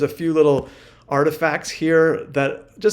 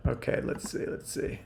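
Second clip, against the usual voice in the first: whispering to self; 11 dB quieter than usual. Real, not acted. Muttering to self while loading.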